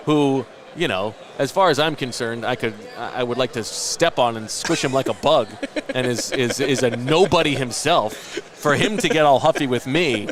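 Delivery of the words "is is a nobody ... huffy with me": ordinary talk, nothing marked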